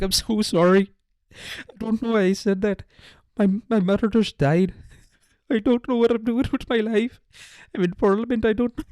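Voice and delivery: high-pitched, hammed-up tearful apology; mock drunk crying. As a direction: blubber like a sorry drunk. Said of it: "with the drunk crying".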